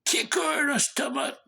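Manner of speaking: high, strained tempter's voice; devil's urging. Retold or imitated. Devil tempting an angry man to attack, urgent goading. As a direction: egg someone on like a little devil. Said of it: "the devil is saying".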